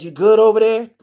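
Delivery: calling over to Bear in his cell. Retold or imitated. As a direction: call out to the next cell. Calling across isolated jail cells.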